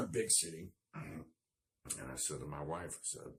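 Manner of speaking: usual speaking voice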